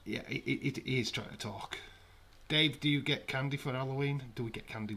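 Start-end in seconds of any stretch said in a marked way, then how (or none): none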